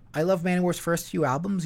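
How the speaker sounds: usual speaking voice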